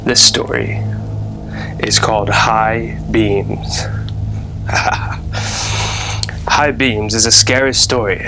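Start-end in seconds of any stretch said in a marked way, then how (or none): 0.0-3.8 slow, drawn-out ominous title; spooky title reveal. Acted. Storyteller announcing the story's title, slow and ominous. 3.9-6.4 soft breathy sinister chuckle; a hushed evil laugh; creepy whispered laugh. Acted. Storyteller laughing menacingly after the title, whispered.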